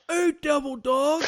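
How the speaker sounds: drawn-out hail of a sergeant; calling out. Retold or imitated. Sergeant calls out across parking lot.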